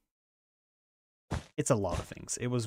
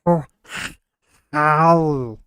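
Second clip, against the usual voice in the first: Stitch voice; 6 dB louder than usual. Retold or imitated. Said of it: "maybe you say stuff like".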